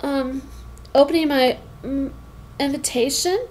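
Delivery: soft, halting stammer. A narrator's reading. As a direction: stumble out the words, unsure. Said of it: "I stammered".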